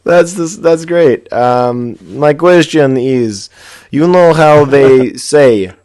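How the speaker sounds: put-on loud, slow Russian accent. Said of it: "read my email in a Russian accent, please".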